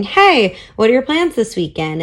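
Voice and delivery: retold breezy text. Voices her upbeat text to him.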